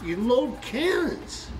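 high, slow and emphatic; triumphant announcement. Acted. Russian soldier pointing at the Polish soldiers, excited. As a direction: stretch each word out high, as if sure of the guess.

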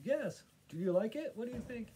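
high-pitched, eager impression of a delighted woman; beaming artist. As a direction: imitate her beaming with pride, voice lifted way up. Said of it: "this gal just beaming at me".

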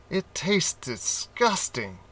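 monkey grimaces at the firewater taste; disgusted monkey. A narrator's reading. Monkey tastes fire water.

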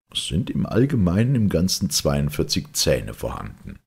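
usual speaking voice

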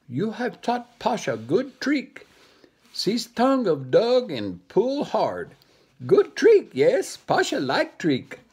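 high, gleeful monkey voice; gloating monkey. Acted. Monkey gloating to the dog over his trick, high and gleeful.

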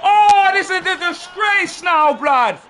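slow, drawn-out high yell; real exasperated outcry; disbelieving shout. Real, not acted. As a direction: howl in horror as he goes through. Opponent through on goal, shouting.

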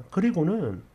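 usual speaking voice

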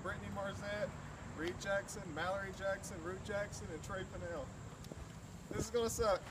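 high, tense, bracing for cold; nervous and high before the dunk; nervous before ice water. Real, not acted. Accepting the Ice Bucket Challenge.

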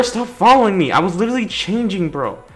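normal talking voice

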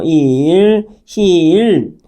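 long 이 in 일 and 힐; long vowel. Shown as a demonstration. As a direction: stretch the vowel of 일 and 힐. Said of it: "이 길게 발음하죠".

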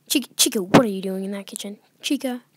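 loud, fast scolding call at Chica; exasperated loud call toward the screen; calling out loudly. Real, not acted. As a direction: yell at her to get out of the kitchen. Scolding animatronic in game kitchen.

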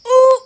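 short, loud, high "ooh"; sudden high whoop of surprise; sharp yelped ooh. Real, not acted. Reacting during the game.

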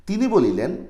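usual speaking voice

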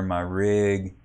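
ordinary talk, nothing marked

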